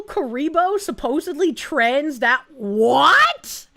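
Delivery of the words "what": loud, rising cries of shock; incredulous outburst; shocked disbelief. Real, not acted. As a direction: yell it, climbing much higher in disbelief. Reacting to shocking news about someone.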